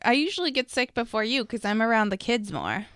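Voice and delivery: normal talking voice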